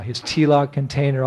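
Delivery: ordinary talk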